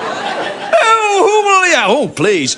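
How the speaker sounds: high, hammed-up bad acting; amateur Juliet. Middle-aged Juliet in village play, hammed-up wail. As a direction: wail it like a terrible amateur actress. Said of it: "it was li-, like".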